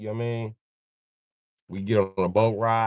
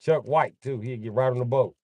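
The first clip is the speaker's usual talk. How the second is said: usual speaking voice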